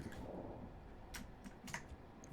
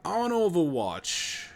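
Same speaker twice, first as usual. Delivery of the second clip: slow, deadpan copy of the radio line; imitating soldier callout; 6 semitones higher, much slower than usual. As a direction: echo the soldier's callout slowly and flat. Mimics the game voice line.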